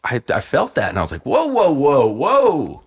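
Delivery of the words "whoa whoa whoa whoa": loud, high startled whoa; as if jolted awake. Retold or imitated. Retelling his own startled reaction.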